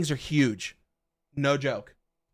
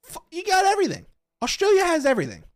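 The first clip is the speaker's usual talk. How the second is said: exasperated outburst; 14 semitones higher, 4 dB louder, faster than usual. Real, not acted. Exasperated about Australia.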